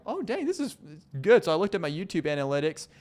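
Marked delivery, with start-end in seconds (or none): 0.1-1.4 squeaky, very high delight; delighted surprise. Retold or imitated. Host reacting to his podcast views, squeaking in delight. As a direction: gasp it out in happy disbelief. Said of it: "I was like".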